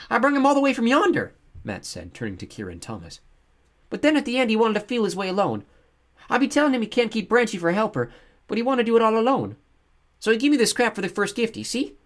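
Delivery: put-on high, loud childish chatter; excited boy Matt. Matt telling Kira and Thomas, excited. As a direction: rattle on like an eager little boy.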